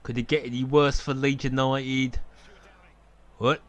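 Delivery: ordinary talk, nothing marked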